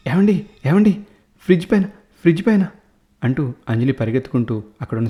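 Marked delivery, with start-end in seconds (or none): 0.1-2.7 panicked, high and fast calling; frightened cry. A narrator's reading. Wife fleeing the fridge to her husband, terrified and fast. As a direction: gasp it out in terror.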